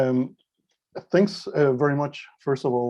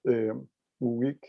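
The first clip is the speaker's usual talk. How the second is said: usual speaking voice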